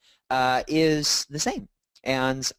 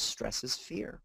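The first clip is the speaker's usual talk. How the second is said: quiet verdict; 11 dB quieter, much slower than usual. Retold or imitated. Counselor's verdict retold.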